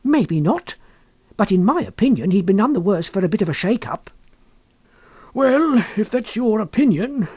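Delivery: a lightly voiced wife with a grudge. A narrator's reading.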